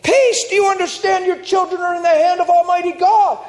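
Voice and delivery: high, loud urgent shout; yelling at her. Retold or imitated. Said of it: "hears me yelling at the lady".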